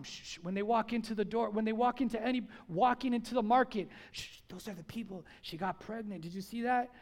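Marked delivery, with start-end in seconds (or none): 0.0-0.4 hushed gossip shush; whispered shushing. Retold or imitated. 4.1-6.9 low, hushed gossip voice; gossiping whisper. Retold or imitated. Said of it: "People would whisper about them".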